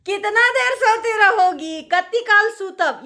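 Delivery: high, loud impatient scolding; scolding call. Retold or imitated. Mother-in-law's third, angry call to the sleeping bride. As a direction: shout her out of bed. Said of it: "फिर एक तीसरा आवाज़ आता था".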